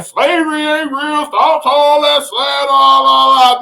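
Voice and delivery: a taunting imitation of [name] at TMZ; mocking [name].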